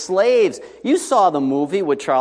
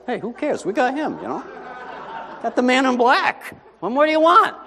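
high, laughing, carefree boast; Princess Bride quote; 9 semitones higher than usual. Acted. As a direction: laugh it off like the fight is already won. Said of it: "it's like the Princess Bride".

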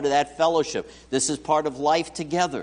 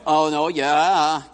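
ordinary delivery

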